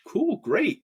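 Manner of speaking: high, sarcastic mock cheer; cool, great sung out high and hammy; mock excitement. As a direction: fake a bright cheer. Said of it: "I was like".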